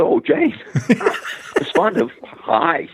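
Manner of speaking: high, laughing, starstruck stammer; man stunned that Jane Fonda is phoning, laughing surprise; flustered surprise. Retold or imitated. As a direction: caught off guard by a famous caller, gushing.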